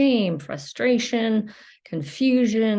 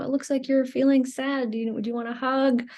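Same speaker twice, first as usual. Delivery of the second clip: high, tender parent voice; gentle parent; 6 semitones higher, faster than usual. Retold or imitated. Parent gently soothing a crying child, tender.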